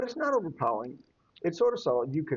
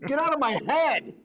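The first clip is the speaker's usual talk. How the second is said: mock outburst; 9 semitones higher than usual. Jokey outburst.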